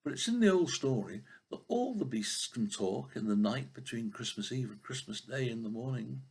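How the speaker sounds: usual speaking voice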